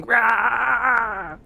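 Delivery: high mock "Rah!" war cry; mock battle cry. Streamer imitating the boss yelling at a wall, high mock battle cry. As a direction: ham up the boss's battle yell. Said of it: "staring into a wall with her shield down going".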